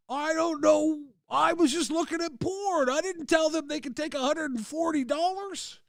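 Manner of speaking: dad pleading innocence, high and helpless; cartoonish high-voiced dad excuse; whiny dad voice. Dad defending porn dialer charges.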